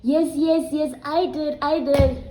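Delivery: high, loud, urgent interjection; Ntombi. Retold or imitated. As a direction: imitate a frantic friend calling down the phone. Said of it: "There is Ntombi saying".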